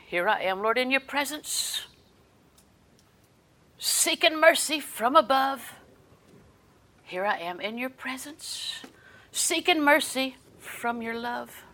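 slow, drawn-out prayer; slow devotional chant, lingering on the words; prayerful recitation. Acted. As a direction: lift it up as a plea to the Lord.

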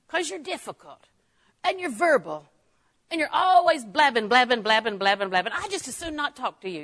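mock a chatterbox, half teasing; mocking complaint. Mocks a talkative person.

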